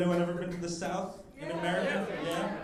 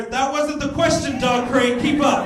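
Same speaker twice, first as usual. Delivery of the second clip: louder, higher mock telling-off; mock scolding; 6 semitones higher, 10 dB louder than usual. Comic scolding a beer drinker in the crowd, mock angry shout. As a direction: bark at the heckler in fake outrage.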